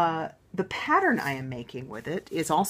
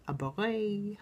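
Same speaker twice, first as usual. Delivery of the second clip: put-on a beret said lightly in a French accent, a little higher; 6 semitones higher, 4 dB quieter, slower than usual. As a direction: toss off the word with a playful French accent. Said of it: "I suddenly did that in a French accent".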